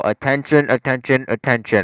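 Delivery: imitated alarm announcement. Student mimicking the dorm alarm, announcement voice. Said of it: "we hear the thing go".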